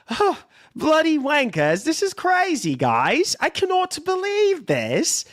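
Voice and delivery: lightly put-on British accent, cheering PS5 sales. Co-host reads PS5 news in a lightly mocking British accent. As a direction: hams up a British lad gloating over sales. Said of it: "[name] is sounding like he's mocking us U K lads".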